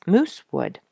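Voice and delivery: ordinary delivery, nothing marked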